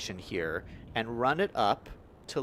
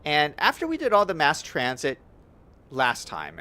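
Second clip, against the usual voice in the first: ordinary talk, nothing marked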